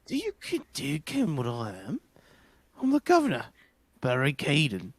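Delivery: put-on amateur Scottish accent for a prison governor; shaky attempt at Scottish. Governor introduces himself to visitors, attempted Scottish accent. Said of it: "I can't do Scottish".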